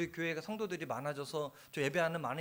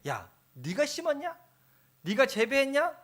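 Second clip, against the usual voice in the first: God challenging Jonah; 8 semitones higher, 7 dB louder than usual. Retold or imitated. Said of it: "하나님의 이야기예요".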